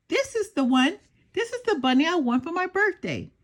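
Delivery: put-on a child showing off her birthday bunny; little girl voice. A narrator's reading. Little girl proudly claims her bunny, bright and eager.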